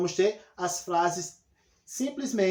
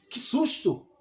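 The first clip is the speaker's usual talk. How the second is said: susto encenado; 3 semitones higher, slower than usual. Shown as a demonstration. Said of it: "expressando uma, uma emoção".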